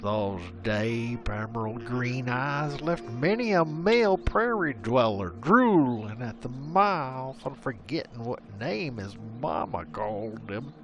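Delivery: usual speaking voice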